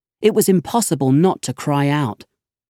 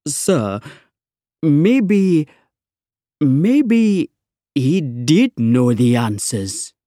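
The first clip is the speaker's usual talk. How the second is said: timid; much slower than usual. Acted. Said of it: "almost too afraid to say it".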